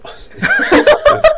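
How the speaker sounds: loud high burst of laughter; real high-pitched laughing. Real, not acted. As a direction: crack up at your own pun. Laughing at own pun.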